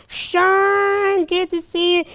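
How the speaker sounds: bright high mock girl voice, very high; high girly greeting. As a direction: squeal her greeting up high.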